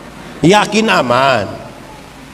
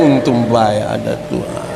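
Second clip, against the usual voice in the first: mock grateful chuckle, lower; laughing relief; 7 semitones lower than usual. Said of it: "Kalau sone mama mati".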